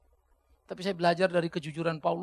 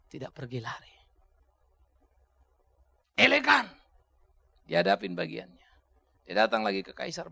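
usual speaking voice

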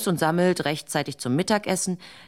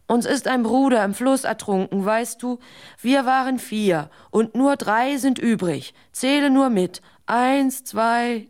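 distressed pleading; 6 semitones higher, 3 dB louder than usual. Acted. Distraught dunces tell wanderer.

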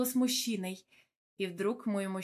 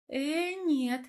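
higher, drawn-out brush-off; dismissive; 6 semitones higher, 4 dB louder than usual. A narrator's reading. Wife waving off her husband's wedding plan, dismissive. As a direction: wave the idea away with a rising voice. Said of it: "отмахнулась я от его слов".

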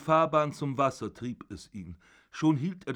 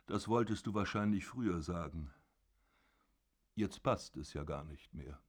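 softer, offhand, with mock pity; pitying aside; 5 semitones lower, 5 dB quieter than usual. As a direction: toss it off with condescending pity. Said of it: "Mitleidig sagte der Vater nebenbei". (mitleidig nebenbei, leiser)

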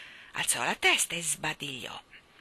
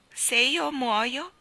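very slow, high, louder warning; 7 semitones higher, 5 dB louder, much slower than usual. Acted. Sulking boy warning his nanny he might die. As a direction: stretch it out like a grave threat. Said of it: "ammonì".

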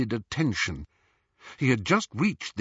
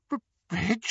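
shocked; 10 semitones higher than usual. A narrator's reading. Mrs Cattermole shocked.

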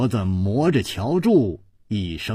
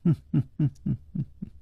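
fast, soft hmm-hmm giggle; a teasing little laugh; soft flirtatious titter; coy giggle; 7 dB quieter, much faster than usual. A narrator's reading. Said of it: "而且还笑了笑".

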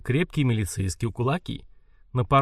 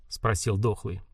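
plain reading voice

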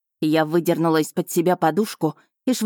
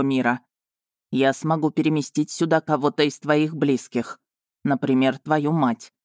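a hint of a lower, calm male voice; lightly voiced dragon; 3 semitones lower than usual. A narrator's reading. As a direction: play the dragon steady and sure.

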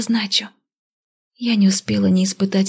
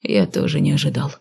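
grumbling; 5 semitones lower than usual. Acted. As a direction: mutter it grudgingly. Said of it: "буркнул он недовольно".

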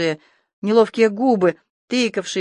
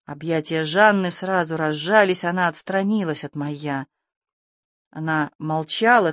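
ordinary delivery

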